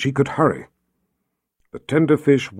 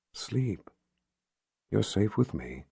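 much softer, near-whispered comfort; hushed lullaby; 11 dB quieter, slower than usual. A narrator's reading. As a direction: lull her to sleep, barely breathing the words. Mother lulling child to sleep.

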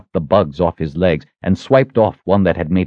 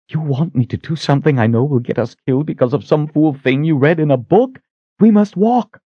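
high-pitched incredulous objection; Sister Verna, indignant; 9 semitones higher than usual. A narrator's reading. Sister refusing Richard's reckless plan, indignant. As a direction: protest in a raised voice, ending firmly.

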